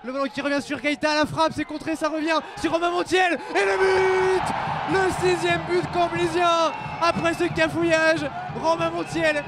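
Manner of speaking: shouted goal call; excited sports commentary. Real, not acted.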